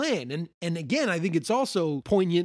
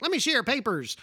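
higher, official sentry voice; sentry demanding papers; 8 semitones higher, faster than usual. Retold or imitated. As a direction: demand the papers like a strict guard. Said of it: "He's like".

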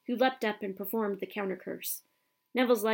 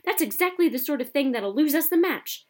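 loud, higher scolding shout; yelling; 6 semitones higher, 7 dB louder than usual. A narrator's reading. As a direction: keep yelling at the players. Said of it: "he yelled".

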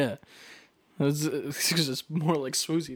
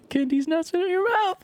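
very high, squeaky meme voice; 4 dB louder than usual. Pretend. Delivering the meme joke to a co-host, very high and squeaky. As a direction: squeal the punchline.

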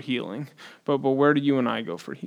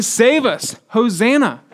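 high, loud cry to be saved; crowd shouting; 6 semitones higher, 4 dB louder, slower than usual. Retold or imitated. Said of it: "These people are shouting".

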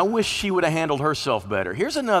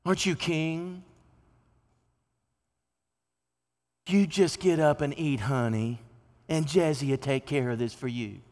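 Jezebel mothering; 4 dB quieter than usual. Acted. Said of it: "his wife, said to him".

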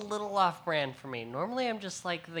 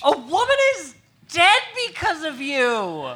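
loud, high accusing shout; outraged accusation; 9 semitones higher, 9 dB louder than usual. Acted. Student blaming classmate for a death, outraged. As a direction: hurl the blame at full volume.

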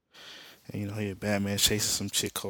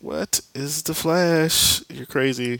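loud, high mock disbelief; incredulous; 6 semitones higher, 7 dB louder, slower than usual. Mom hears her son is the Flash, incredulous. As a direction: draw it out high and loud, scoffing.

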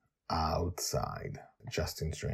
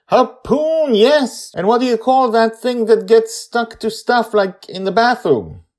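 put-on high, loud kid voice explaining; eager child; 14 dB louder than usual. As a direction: describe it like a keen little girl. Joy answers Grandpa eagerly.

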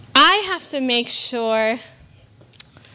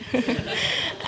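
soft breathy laugh; 8 dB quieter than usual. Real, not acted. Laughs after mock tough voice.

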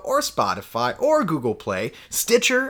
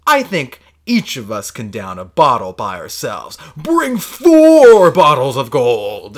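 grand order; 4 semitones lower, 7 dB louder, slower than usual. Retold or imitated. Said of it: "And he goes".

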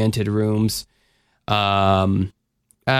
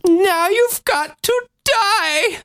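very high, slow, strange villain voice; demented German professor; much slower than usual. Retold or imitated. Imitating a killer to his co-host, mad professor voice. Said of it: "like a demented German professor".

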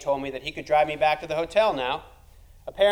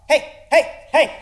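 high, loud doglike yelps of hey; yelping hey while chasing a car; barking like a dog; 11 semitones higher, 10 dB louder than usual. Acted. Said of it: "chase a car down the street yelling".

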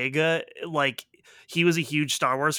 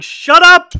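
loud, much higher shut up; exasperated shout; 14 semitones higher, 16 dB louder than usual. Real, not acted. Friend yelling at the movie's twist, exasperated. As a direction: yell it at the screen.

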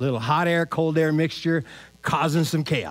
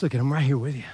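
reassuring Jesus; 6 semitones lower, 3 dB quieter, faster than usual. Retold or imitated. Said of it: "he reminded the guys".

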